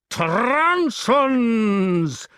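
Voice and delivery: high, long-rolled single word; drawn-out gloating threat; threatening giant. A narrator's reading. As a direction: roll and stretch the word with menace. Giant relishes his threat.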